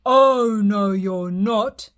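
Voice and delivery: loud, slow troll roar; loud troll refusing the middle goat. Acted. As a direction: bellow it slowly. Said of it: "roared the troll".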